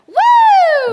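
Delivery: loud high whoop; cheer. Retold or imitated. Girl ending her movie reaction retelling with a cheer. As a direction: let out a big happy whoop.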